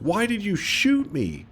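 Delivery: high, slow put-on whine; hammed-up high hurt whine; shot victim. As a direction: plead it in a squeaky voice. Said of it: "He's like".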